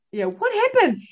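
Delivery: coy playful question; mock-innocent. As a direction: play dumb to tease her. Teasing the midwife who missed it.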